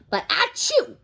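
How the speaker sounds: a big booming achoo, high and loud; huge sneeze. A narrator's reading. Mister Noisy sneezes so the next country hears, huge sneeze. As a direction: blast out the sneeze.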